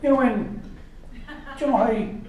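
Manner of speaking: a lightly voiced, meandering Irish comic, laughing; Jimeoin impression. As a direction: ramble like a confused Irish comic. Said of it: "Jamoan could run Ireland, you know, and be like".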